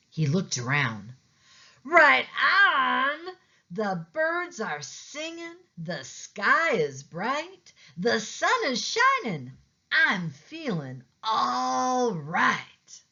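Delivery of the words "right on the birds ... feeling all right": high, bright-eyed cheer with a crying edge; excited cat. A narrator's reading. Pete cheering as he sees the world anew, high and delighted.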